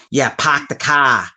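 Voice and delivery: a light, slowed mock Boston accent. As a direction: lightly tease the Boston accent, like Ben Affleck. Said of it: "a Ben Affleck accent".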